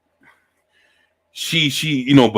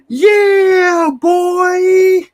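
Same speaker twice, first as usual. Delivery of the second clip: much higher, louder hype-man cry; 5 dB louder than usual. Retold or imitated. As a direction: bark it like a hype man on stage. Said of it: "and he was like".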